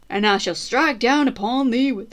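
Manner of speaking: put-on a Southern US preacher accent, loud and soon abandoned; attempted Southern US accent. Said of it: "I'm trying to do it with southern accent".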